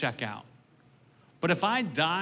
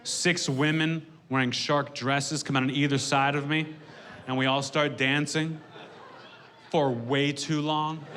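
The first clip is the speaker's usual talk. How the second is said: ordinary talk, nothing marked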